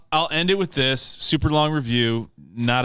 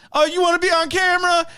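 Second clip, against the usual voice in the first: much higher, quick come-on imitation; hammed-up flirty invitation; mock sleazy pickup line; 4 dB louder, faster than usual. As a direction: hit on her with a cheesy grin. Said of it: "he's like".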